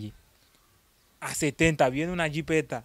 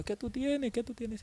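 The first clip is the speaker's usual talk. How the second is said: worried mother; 11 semitones higher, 4 dB quieter than usual. Retold or imitated. Mother voiced asking him.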